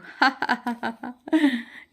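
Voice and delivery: fond falling laugh; amused laugh. Acted. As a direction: laugh at the parrot's antics. Shalu laughs at parrot. (हँसी)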